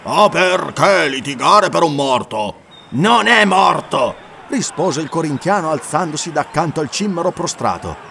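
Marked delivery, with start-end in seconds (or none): 0.0-2.6 loud, slow call over the crowd; slow, loud exasperated question; raised voice. Acted. Shemite raises voice over clamour. 2.9-4.2 loud, slow, higher declaration; slow emphatic shout; loud denial. Acted. Corinthian answers from beside Conan.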